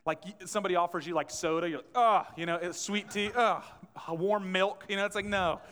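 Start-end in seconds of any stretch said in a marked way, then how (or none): 2.0-2.2 disgusted ugh. Retold or imitated. Disgust at sweet drink offer. 3.4-3.6 disgusted ugh. Retold or imitated. Disgust at warm milk offer.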